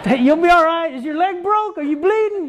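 high, offhand mock questions; brisk tough dad. Dad checking on fallen child, mock brusque and high.

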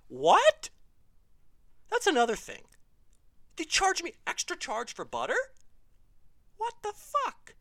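loud, high-pitched indignant disbelief; comic outrage. Acted. Comedian protesting the extra charge for butter, comic outrage. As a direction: blow up in exaggerated disbelief.

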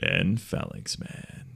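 low, slow, breathy sultry voice. Acted. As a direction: purr the name into the mic like a perfume ad. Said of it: "the sultry voice over here".

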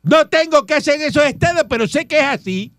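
high, loud, indignant insistence; angry retort. Real, not acted. Patrón answering a doubt about his houses, angry. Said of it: "no tiene que enojarse".

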